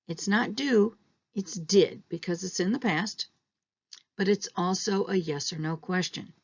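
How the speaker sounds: normal talking voice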